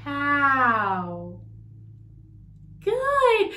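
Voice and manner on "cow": low cow word. Shown as a demonstration. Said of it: "How about cow?".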